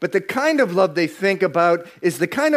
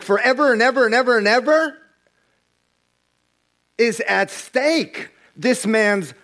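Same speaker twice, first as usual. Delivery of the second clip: rising fervor; 8 semitones higher than usual. Real, not acted. Pastor stressing eternity to the congregation, rising fervor. Preacher building to emphasis.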